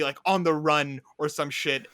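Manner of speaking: ordinary talk, nothing marked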